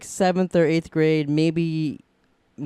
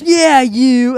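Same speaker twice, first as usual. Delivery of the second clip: loud, higher insistent yell; shouting back; 8 semitones higher, 10 dB louder than usual. Retold or imitated. Biker yelling at the boy holding the dog, loud. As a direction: call it out so he can't miss it.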